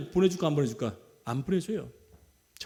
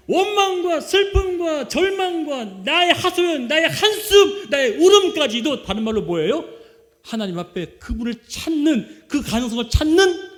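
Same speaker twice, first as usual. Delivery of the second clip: high, loud, slow impassioned preaching; fervent preacher pleading over the congregation; full-force preaching; 9 semitones higher, 8 dB louder, slower than usual. Real, not acted. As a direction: pour it out as a crescendo.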